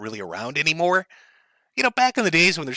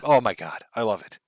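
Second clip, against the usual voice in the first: ordinary delivery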